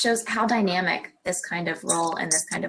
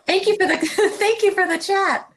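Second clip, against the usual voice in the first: laughing; 9 semitones higher, 5 dB louder than usual. Real, not acted. Laughs at audio confusion.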